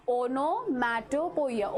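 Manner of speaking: slow, careful model of onomatopoeia; slow pronunciation model. Shown as a demonstration. Teacher to class, slowly modelling the pronunciation. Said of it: "The pronunciation for this word".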